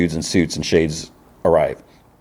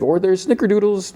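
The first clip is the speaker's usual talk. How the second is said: much higher, hopeful offer; desperate cheer; 14 semitones higher than usual. Retold or imitated. Jimmy calls after the departing son, high hopeful offer. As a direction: toss it out high, hoping to save the moment.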